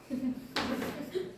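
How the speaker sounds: soft, high chuckle; laugh. Real, not acted. Teacher amused at a joke about eighteen, quiet high laugh.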